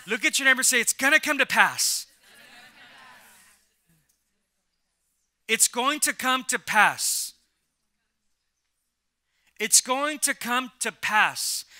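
loud, then slowing on each repeat; preaching at full force. Real, not acted. Pastor drives home promise.

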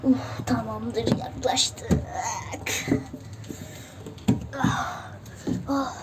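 panting after a climb; breathless from climbing; out of breath. Real, not acted. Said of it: "bayağı yorucuydu".

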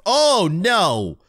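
loud, high panicked shout; alarmed shout. Real, not acted. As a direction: yelp it in dismay. Got hit by red platform.

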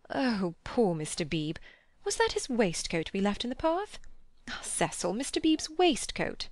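dismayed matron, leaping high in pitch; fussing Missus Honeychurch, dismayed. A narrator's reading. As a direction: fuss and fret, rising into high exclamations.

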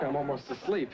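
ordinary delivery

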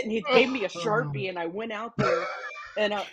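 normal talking voice

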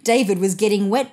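ordinary delivery, nothing marked

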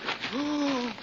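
startled gasp. Acted.

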